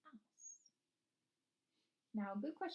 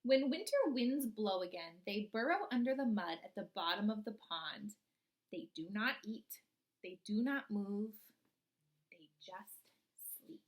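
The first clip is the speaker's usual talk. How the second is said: storybook reading; 6 dB louder than usual. Acted. Teacher reading a frog book to her class, storybook voice. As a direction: read it like a picture book to the class.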